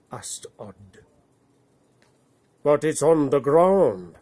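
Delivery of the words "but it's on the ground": loud, doubtful bear voice, pitched up; a bear unconvinced, speaking up. Acted. Bear doubting the rainbow bridge, loud and puzzled. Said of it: "said the bear doubtfully".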